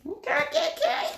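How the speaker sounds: rising, squealing okays; delighted squeal at the cards; excited okays. Real, not acted.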